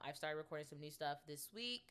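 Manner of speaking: usual speaking voice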